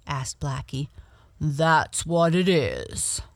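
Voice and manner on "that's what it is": slow, louder bear voice; lightly voiced dancing bear; Dido. A narrator's reading. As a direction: confirm it slowly as a big bear. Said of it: "answered Dido".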